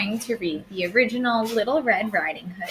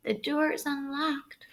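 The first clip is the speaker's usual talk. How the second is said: higher, much softer frail voice; frail grandmother; 6 semitones higher, 6 dB quieter than usual. Acted. Sick grandmother answers the door, frail and soft. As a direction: answer weakly from the sickbed.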